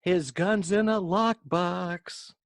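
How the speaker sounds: acted movie quote. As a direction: voice climbing high on the second part. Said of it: "so he actually goes".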